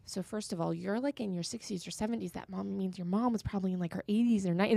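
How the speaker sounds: softly voiced thoughts; inner thought. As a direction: reason it out quietly, as if to yourself. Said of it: "that's all going on in my head".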